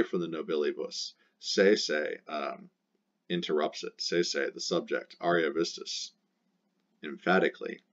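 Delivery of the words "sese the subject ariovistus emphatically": ordinary delivery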